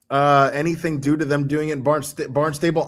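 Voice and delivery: normal talking voice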